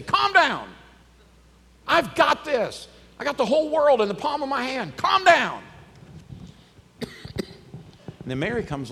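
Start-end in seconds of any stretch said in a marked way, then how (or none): 0.0-5.6 high, loud coach's shout; full-voiced, commanding holler; forceful command. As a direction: yell it to settle a worked-up player. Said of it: "grab by the face mask and say".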